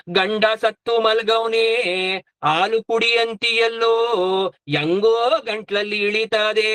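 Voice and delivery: slow, sing-song widow's lament; mock wailing. Wife offered milk beside her dead husband, mock wailing. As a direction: hold each last vowel in a hammed-up wail.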